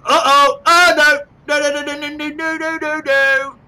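loud, high panicked cries; high alarmed string of no's. Real, not acted. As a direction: yelp in alarm as it goes wrong. Ship about to crash, panicking.

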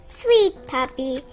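usual speaking voice

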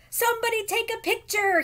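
high, exultant call; triumphant yell. Acted. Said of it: "he yelled".

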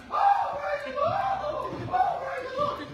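high, soaring mock praise from an old preacher; imitating his dad. Son imitating his preacher dad at the pulpit, high ecstatic praise. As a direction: swoop way up like dad in full praise. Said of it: "what my dad does up here every sermon".

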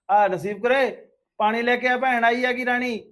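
loud, high-pitched call across the house. Acted. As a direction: shout an order for water to someone further off. Calls household member to bring water. (ਉੱਚੀ ਆਵਾਜ਼ ਵਿੱਚ ਹਾਕ)

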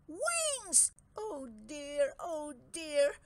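high, crying dragon cry; distressed dragon. Acted. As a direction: cry out in dismay. Dragon shocked, starts sobbing.

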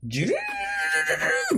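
rolled slurping noise, rising sharply; cartoon slurp sound effect; imitated slurping. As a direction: mimic noisy eating with a long rolled slurp. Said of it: "ご飯食べる時の顔".

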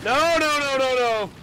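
loud, high panicked no's; panicked shouting. Real, not acted. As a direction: yell in desperate panic. Hunt about to fail.

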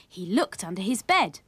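ordinary delivery, nothing marked

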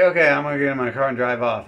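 fast, shouted exit line; loud hurried brush-off to the NPC; loud fed-up announcement. Retold or imitated. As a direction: bark it out fast and done with him. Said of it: "And I'm like".

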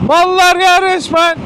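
high, loud, slow exasperated yell; furious outburst. Real, not acted. Courier ranting to camera about customers, furious high yell. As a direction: rage at the dumb customers.

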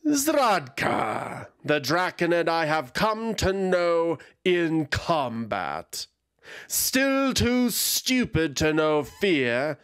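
looks down on her foe; contemptuous dragon. Acted. Dragon Mesiak sneering at her old rival Zradka.

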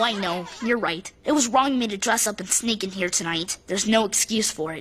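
low, subdued admission of guilt; contrite confession. Acted. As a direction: own up remorsefully. Boy confesses before the court.

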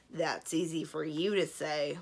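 slow dragon grumble; grumbling. A narrator's reading. Dragon envious that Kazul has a princess, grumbling. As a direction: grumble slowly, put out. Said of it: "one of the other dragons grumbled".

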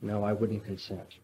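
a hushed, low reluctant no; quiet answer. Retold or imitated. The monk answers Ivan's challenge, hushed. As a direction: give the refusal almost under your breath. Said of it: "said Elisha".

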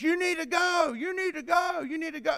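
mock a fiery preacher hammering the point; imitating a shouting preacher. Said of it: "I've heard preachers preach".

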